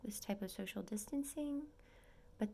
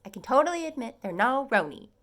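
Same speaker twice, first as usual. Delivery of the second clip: loud, high gushing fan; excited gush; 6 semitones higher, 11 dB louder than usual. Real, not acted. Gushing to viewers about her favorite cast, loud and excited. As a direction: rave about it, loud and giddy.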